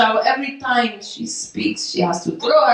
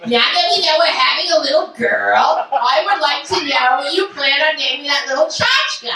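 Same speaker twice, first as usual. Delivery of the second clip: mother-in-law impression; 4 semitones higher than usual. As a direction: do the mother-in-law, prim and pushy. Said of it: "I did an impression of her".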